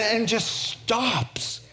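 usual speaking voice

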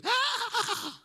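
loud, high-pitched sudden "ah"; imitated corpse cry. Dead dad's cortex fires off, startling cry. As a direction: mimic a dead body jolting out a cry. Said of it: "and he goes".

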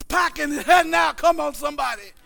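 rhythmic preaching climbing steadily to a loud peak; building fiery preaching. Real, not acted. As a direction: start firm, build to full-force revival preaching. Preacher builds a call-and-response to full force.

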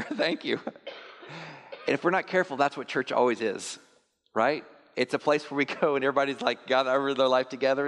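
usual speaking voice

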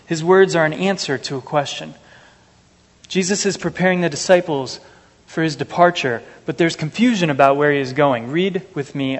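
ordinary talk, nothing marked

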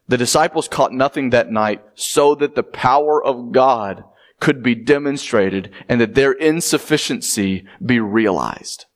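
usual speaking voice

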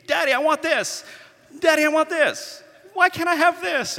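put-on high, whining child begging for toys; pleading toddler whine; whiny kid voice. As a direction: beg like a little kid, way up high. Kids begging in toy aisle.

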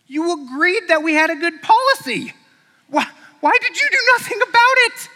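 high, loud incredulous rant; outraged scolding. Acted. Shepherd berating the boy for doing nothing. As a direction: voice climbing in disbelief.

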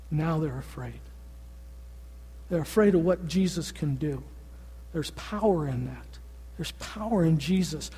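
preacher softening to stress God's power; hushed and solemn. Real, not acted. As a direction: draw them close with a near hush. Solemn point of the sermon.

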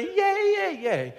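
high, sarcastic mock cheering; hammed-up hollow cheer. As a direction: fake cheerleader enthusiasm, pitch jumping up. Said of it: "don't just champion".

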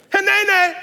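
high ringing proclamation; proclaimed. Shown as a demonstration. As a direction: ring it out high and loud. Preacher proclaims the word.

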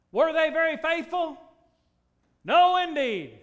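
high, loud ringing declaration; preaching shout. Acted. Preacher judging Israel's faithlessness, loud. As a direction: belt it to the rafters.

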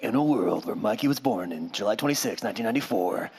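half-joking trailer announcer; movie announcer voice. Acted. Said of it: "like movie announcer voice".

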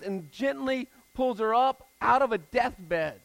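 loud, high, impassioned sermon voice; fervent rising preacher's cry; preaching at full force. Acted. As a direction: proclaim it to the whole room. Preaches the resurrection.

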